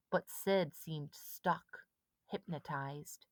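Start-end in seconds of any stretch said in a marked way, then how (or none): none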